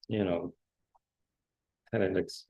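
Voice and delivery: ordinary delivery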